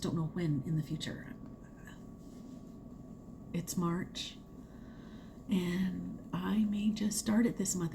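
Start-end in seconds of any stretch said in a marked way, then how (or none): 3.5-7.9 whispered like a guilty secret. Real, not acted. Vlogger whispers her plan to viewers, whisper. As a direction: breathe it out, no voice, a naughty confession.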